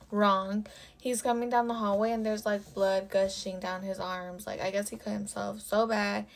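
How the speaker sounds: usual speaking voice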